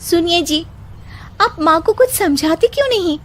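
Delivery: high, whiny wife's complaint; voiced as the wife, pitched high; complaining wife. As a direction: nag her husband in a high plaintive voice.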